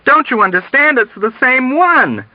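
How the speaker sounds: high voice rising over commotion; exasperated high-pitched shout; emphatic shouting. A narrator's reading. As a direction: insist urgently over the uproar. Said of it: "voice rising clearly above the raucous commotion".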